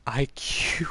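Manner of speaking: breathy whisper tossed off; quick hushed aside. Real, not acted. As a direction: drop to a whisper for "I Q".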